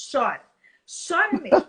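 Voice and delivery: loud, punchy acted encouragement; emphatic urging. Mother telling her children to let themselves cry, emphatic.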